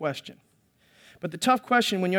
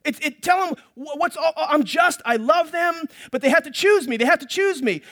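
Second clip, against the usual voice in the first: much higher, louder, urgent pleading; God pleading; 7 semitones higher, 4 dB louder than usual. Acted. God urging the prophets to tell his people, raised pleading voice. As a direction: beg like a desperate father.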